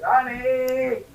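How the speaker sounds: half-hearted call of her name; a mild reenacted call, not full voice; yelling across the room. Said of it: "I said".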